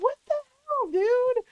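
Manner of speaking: high squeaky what the hell; shocked disbelief. Retold or imitated. Hearing what best friend told the ex, high shocked squeal. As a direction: retell your own jaw-drop at her.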